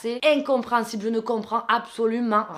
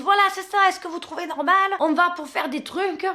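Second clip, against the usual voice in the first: woman's shrill appeal; 8 semitones higher than usual. Retold or imitated. Woman demanding the neighbour agree it's not normal: high outraged.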